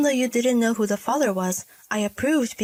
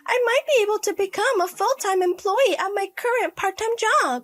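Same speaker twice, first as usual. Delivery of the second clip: high-pitched, insistent daughter; pleading adult daughter; 10 semitones higher, 3 dB louder than usual. Acted. Daughter wants to stay downtown, pleading. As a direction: argue to stay, voice pitched well up. Daughter resists moving home.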